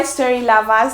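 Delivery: usual speaking voice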